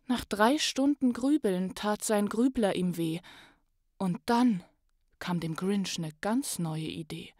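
lively rhyming read-aloud, rising to loud on the joyful parts; story narration. Acted. Podcast host reads the Grinch story to listeners, lively storytelling. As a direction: tell the Grinch tale with swelling excitement.

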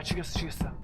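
ordinary talk, nothing marked

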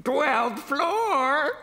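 put-on high-pitched old man's voice. A narrator's reading. Old man in a wheelchair calls his floor, high old voice. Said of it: "said the old man".

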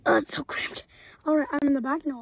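hushed sneaking whisper. Acted. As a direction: whisper so he won't hear you. Sneaking into the car trunk.

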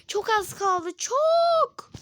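very high squeal; excited squeal. Real, not acted. Kid squealing as the goal nears, very high. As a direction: squeal with tension. Tense near win.

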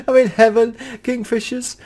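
high, loud giddy laugh through the words; laughing with joy. Real, not acted. Player overjoyed by kingfisher, laughing high. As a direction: laugh out your delight. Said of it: "I'm so excited".

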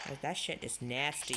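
ordinary talk, nothing marked

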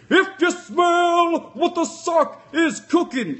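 high, slow, loud drawn-out promo call; hammed-up wrestling promo; wrestler catchphrase. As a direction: stretch it out like a ring announcer hyping the crowd.

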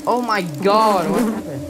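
startled gamer crying out; shocked. Real, not acted. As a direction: caught off guard by the sudden scare. Sudden shock in game.

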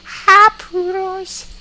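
very high, very slow; sing-song greeting to poodles; silly high voice. Pretend.

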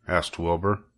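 ordinary delivery, nothing marked

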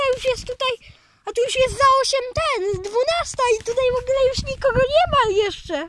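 high, fast and eager, words tumbling out; rushing to tell viewers nobody's out yet; excited. Real, not acted. Excited boy vlogging New Year countdown. (podekscytowany, szybko i wysoko)

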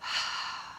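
long breathy sigh out the mouth; dramatic sigh. Shown as a demonstration. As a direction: release the breath in a big sigh. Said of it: "sigh it out".

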